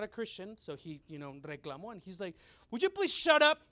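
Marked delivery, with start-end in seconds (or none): 2.7-3.6 high, loud mock-angry demand; exasperated outburst. Man in line telling off noisy ladies, exasperated. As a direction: burst out like a fed-up stranger.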